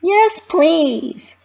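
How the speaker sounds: high, bouncy cartoon pony voice; Pinkie Pie voice. A narrator's reading. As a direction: voice a cheerful pony fretting to herself. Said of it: "she answered".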